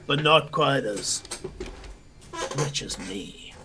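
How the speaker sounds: put-on weary old crocodile speaker; aging crocodilian patron admits he's in their debt; old reptilian noble. GM voices old Chevan speech.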